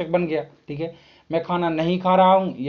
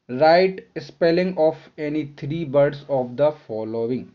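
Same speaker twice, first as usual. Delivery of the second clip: very slow, clear read of the instruction; slow reading aloud; much slower than usual. Shown as a demonstration. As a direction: dictate the instruction to the class. Reads instruction slowly. (बहुत धीमे पढ़ते हुए)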